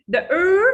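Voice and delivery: "er" said high and full, as in bird; strong er. Shown as a demonstration. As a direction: punch "er" as a strong vowel. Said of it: "it's strong".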